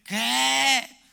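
loud, high, drawn-out incredulous cry; hammed-up shocked yell; mock outrage. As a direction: act out an indignant "what?!". Acted reaction to wife's remark.

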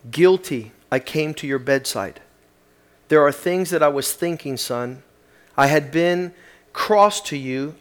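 a remorseful father speaking to his sleeping son; heartfelt letter reading. Acted. Preacher reads a father's remorseful letter aloud to the congregation, heartfelt reading.

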